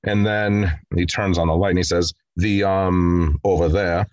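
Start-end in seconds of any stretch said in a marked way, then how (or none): 2.4-4.1 lightly acted awkward hesitation; nervous stammer. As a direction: hesitate as if avoiding an embarrassing word. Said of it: "He stammers and he's nervous".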